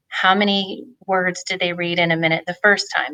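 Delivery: ordinary delivery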